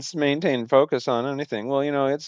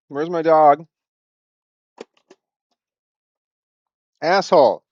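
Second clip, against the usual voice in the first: louder, higher call for the dog; 4 semitones higher, 6 dB louder than usual. Real, not acted. Looking for the dog who ran off, calling loudly. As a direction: call out across the room.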